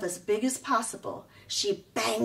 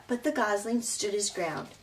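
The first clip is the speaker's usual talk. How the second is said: plain reading voice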